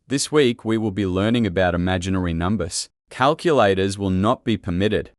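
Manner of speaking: ordinary talk, nothing marked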